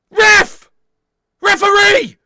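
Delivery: loud, high appeal; shouting at the ref. Real, not acted. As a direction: bellow for the referee's attention. Appealing to the referee.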